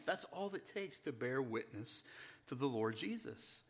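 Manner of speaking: softly, drawing the congregation in close; quiet, intimate sermon voice; hushed preaching. Real, not acted. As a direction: drop to a near hush and let it land. Quiet reflective stretch of sermon.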